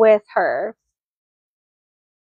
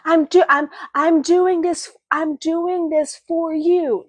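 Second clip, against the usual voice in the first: insistent plea; 6 semitones higher than usual. Retold or imitated. Voicing Henry's plea.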